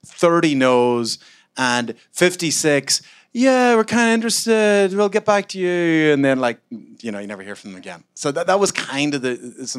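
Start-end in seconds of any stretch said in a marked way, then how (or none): 3.3-6.1 much higher, louder, sing-song fake politeness; mock investor brush-off. Mocking investors who ghost founders.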